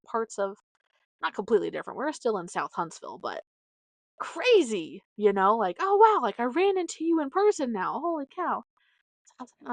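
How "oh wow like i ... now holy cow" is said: higher, louder amazed; excited. Retold or imitated. Stranger amazed to meet him again, excited and higher. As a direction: marvel at the coincidence.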